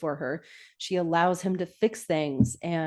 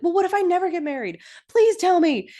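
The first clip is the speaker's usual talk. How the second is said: high, quick mock pleading, building louder; hammed-up pestering; whiny pleading; 12 semitones higher, 6 dB louder than usual. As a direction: act out an exasperated beg. Retelling Lorelai pestering Emily, pleading.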